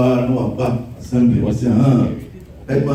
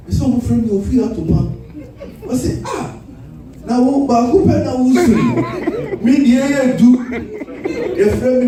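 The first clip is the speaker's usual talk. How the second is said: high mock rant; 10 semitones higher than usual. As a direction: rant it high and quick like a rowdy student. Imitating an excited classmate.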